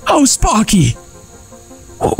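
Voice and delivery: ordinary talk, nothing marked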